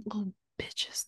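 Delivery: hushed mock-menacing whisper; a pretend aunt whispering a threat to the kids. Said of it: "and I'll be like".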